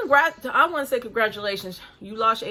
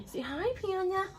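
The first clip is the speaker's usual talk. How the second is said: pet talk; 9 semitones higher, 7 dB quieter than usual. Real, not acted. Asking the dog to greet viewers in high, soft baby talk. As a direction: coo at the dog, much higher and softer.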